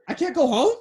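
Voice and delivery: shocked. Retold or imitated. Retells shock at being stranded.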